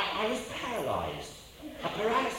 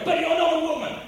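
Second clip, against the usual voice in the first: surprised objection; 10 semitones higher, 10 dB louder than usual. Retold or imitated. Israeli objecting, retold.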